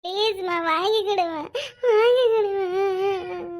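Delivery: slow, drawn-out mock sobbing; fake crying. Son begging mother for money, fake crying. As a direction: beg through put-on wails. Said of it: "அழுவுற மாதிரி நடிச்சாதே எங்க அம்மா நம்புவாவ".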